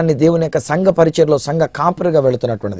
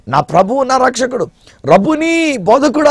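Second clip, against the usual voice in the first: climbing, much higher cry of joy; joyful recognition; 10 semitones higher than usual. Acted. Mary recognizing Jesus, building to a high joyful cry. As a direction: rise to an ecstatic cry on Rabboni.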